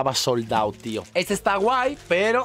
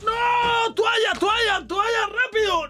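panicked shouts; much slower than usual. Real, not acted. To himself as a drink spills, panicked high shouting.

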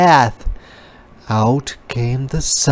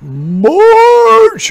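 loud, much higher "March!"; roared; 10 dB louder than usual. A narrator's reading. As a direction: roar the order to march. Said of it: "roared the leader".

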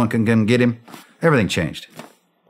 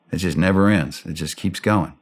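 ordinary delivery

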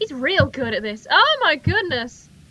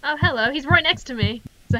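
ordinary delivery

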